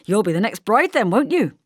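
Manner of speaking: loud, hearty teasing; Harry Trugalla ribbing the bridesmaid jovially. Acted. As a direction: tease her jovially. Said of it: "said Harry Trugalla jovially".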